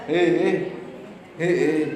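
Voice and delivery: ordinary talk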